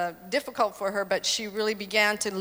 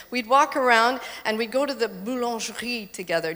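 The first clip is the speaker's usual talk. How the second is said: usual speaking voice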